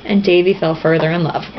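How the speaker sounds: ordinary talk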